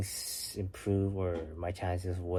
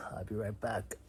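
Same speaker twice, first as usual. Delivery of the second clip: whispered aside to the viewers; 9 dB quieter than usual. Real, not acted. Stepping away to get his charger, whispered to viewers. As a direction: breathe it quietly to the camera. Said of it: "hold on, guys".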